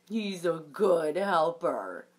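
low, slow and fond; a deep easy dad voice, pleased; lightly voiced proud papa. Papa bunny reassuring Dot about the baby, in a low slow voice.

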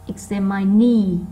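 slow "examine", last syllable loud and held; stressed last syllable. Shown as a demonstration. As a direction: build to a punched final syllable in "examine". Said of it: "nó to hơn những âm còn lại". (đọc chậm, nhấn mạnh âm cuối của "examine")

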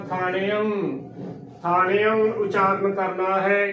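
ਥਾਣਿਅੰ said thaa-ne-am; "thaaneam" for ਥਾਣਿਅੰ; demonstrated pronunciation. Shown as a demonstration. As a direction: open ਥਾਣਿਅੰ into three syllables with a nasal ending. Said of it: "ਇਸ ਦਾ ਉਚਾਰਨ ਕਰਨਾ".

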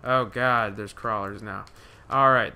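normal talking voice

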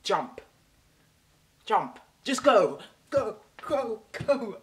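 loud, rising commands to jump in; shouting go. Acted. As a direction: bark at them to just go. Calling out to interrupters.

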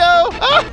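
sharp high scream; screaming in fear. Real, not acted. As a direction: shriek in sudden fright. Spotted by the monster.

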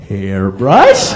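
low, slow puzzled repeat; mock clueless customer; dumb questioning. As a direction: sound baffled by the word. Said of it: "here I'm going".

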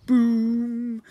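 loud booming drum imitation; big drum boom. A narrator's reading. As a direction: hit it like a huge drum. Drum sound effect.

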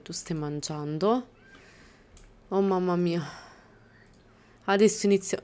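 ordinary delivery

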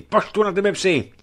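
high, loud cry to stay back; a frightened youth shouting a warning; shouted warning. Acted. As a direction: yell at him to keep away. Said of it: "vykřikl neznámý".

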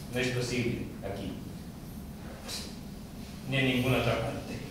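low, hushed, giving up; deflated and subdued; quiet resignation. Acted. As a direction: let it sink to a soft, low murmur. Gives up on inviting anyone.

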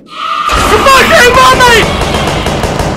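long high drawn-out scream; terrified scream. Real, not acted. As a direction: scream in real fright. Jump scare.

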